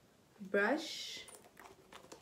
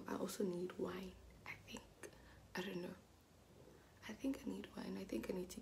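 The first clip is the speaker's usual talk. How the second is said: whisper; 10 dB quieter, faster than usual. Real, not acted. Whispered aside about wine.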